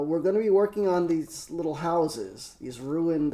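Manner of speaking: ordinary delivery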